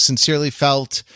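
ordinary talk, nothing marked

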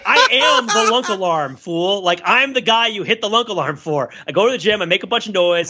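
ordinary talk, nothing marked

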